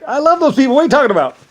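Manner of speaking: high, loud, incredulous defence; indignant protest. Retold or imitated. Defending his people against doubters, high and indignant. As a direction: sound outraged that anyone doubts them. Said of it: "I was like".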